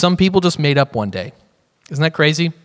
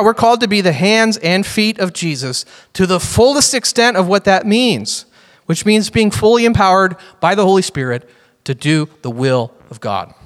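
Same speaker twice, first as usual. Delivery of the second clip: ordinary talk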